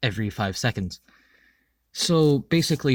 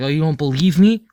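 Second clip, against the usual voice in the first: higher, louder retold retort; playfully challenging, raised voice; mock challenge; 4 semitones higher, 8 dB louder than usual. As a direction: imitate an indignant comeback, pitched up.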